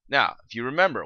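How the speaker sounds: usual speaking voice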